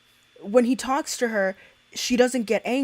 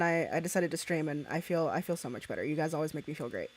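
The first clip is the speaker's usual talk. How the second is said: much softer, heartfelt; soft and sincere; 5 semitones lower, 10 dB quieter, faster than usual. Real, not acted. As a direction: thank them gently. Soft heartfelt thanks to chat.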